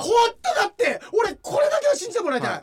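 loud, high-pitched protest; pleading insistence. Real, not acted. Host asking co-host to believe his story, loud pleading insistence. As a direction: shout it, begging to be believed.

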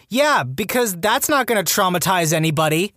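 high-pitched, incredulous sarcasm; sarcastic. Real, not acted. Narrator scoffing at the dean's decision, sarcastic. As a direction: pitch it up in mock agreement. Sarcasm about dean showing the video.

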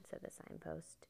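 ordinary delivery

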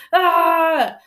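short loud high-pitched yell; primal scream. Shown as a demonstration. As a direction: let out one sharp cathartic scream. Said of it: "primal screaming is a thing".

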